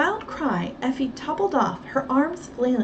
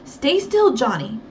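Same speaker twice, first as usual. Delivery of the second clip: warning; 5 dB louder, slower than usual. Acted. Nancy warning the boy, firm and loud. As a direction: warn him firmly. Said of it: "Nancy warned".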